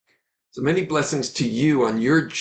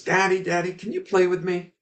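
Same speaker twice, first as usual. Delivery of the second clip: put-on child voice; 6 semitones higher than usual. Little son begging his father.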